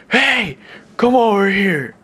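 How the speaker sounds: put-on high, drawn-out drunken call; imitated drunk guy. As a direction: mimic a drunk hailing passers-by. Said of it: "drunk and drugged up".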